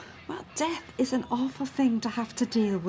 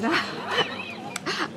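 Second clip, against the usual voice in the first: high, airy burst of laughing; laughter; 7 semitones higher than usual. Acted. Woman amused at her own rabbit excuse, laughing. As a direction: laugh helplessly for a moment.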